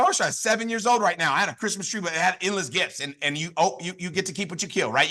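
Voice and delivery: normal talking voice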